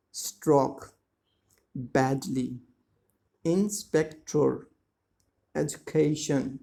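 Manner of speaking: slow, careful pronunciation demo with pauses between words; slow syllable-by-syllable. Shown as a demonstration. Teacher shows how words sound broken up, slowly. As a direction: draw each word out, syllable by syllable. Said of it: "when we say them slowly, they sound like this".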